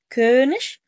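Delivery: كونش loud, long ö, voice rising at the end; model pronunciation. Shown as a demonstration. Teacher asks learner to imitate, loud rising model. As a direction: sound out كونش firmly for copying. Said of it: "اسمع صوت وقلد".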